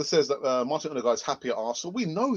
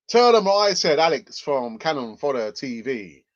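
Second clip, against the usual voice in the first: odd put-on voice; 2 semitones higher, 8 dB louder than usual. Host slipping into a mock captain voice for viewers. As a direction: announce it like a self-important captain. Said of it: "I'm not sure why I'm talking like this".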